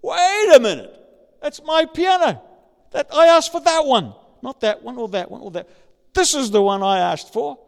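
loud, high shocked protest; high, loud, shocked cry of protest; shocked outburst. Retold or imitated. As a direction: burst out in disbelief. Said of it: "When your shock, surprise is".

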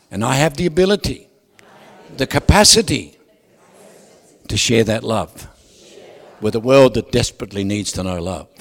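loud, rousing preacher call-and-response; booming motivational speech. Acted. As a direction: boom it out to the congregation. Booming blessing over congregation.

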